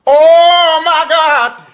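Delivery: drawn-out, very high, loud shriek; shocked shriek. Real, not acted. Co-host reacting to a donation: stretched, very high shriek.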